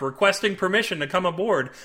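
usual speaking voice

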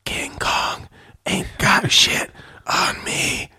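slow whisper; whispered boast. Acted. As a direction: hush the famous line. Cop hushed by librarian.

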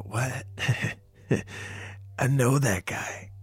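small, soft, slowed voice; lightly voiced little creature. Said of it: "you hear a little voice go".

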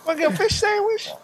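put-on high, fast quoted line from an old clip; high-pitched throwback meme quote; mock clip voice. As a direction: squeak it out like the meme. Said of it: "throwback one".

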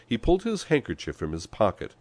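plain reading voice

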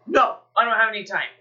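loud, quick brush-off; imitated busy stranger, fast and emphatic; brusque refusal. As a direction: cut them off with an impatient no.

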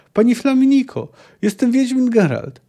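high-pitched call to someone far off; calling out over uproar. A narrator's reading. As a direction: strain upward to be heard over the din. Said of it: "mobilizując do tego wrzasku wszystkie siły". (wołanie wysokim głosem ponad wrzawą)

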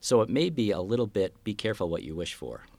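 usual speaking voice

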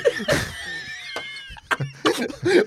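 howling laugh. Real, not acted. After retelling the taunt.

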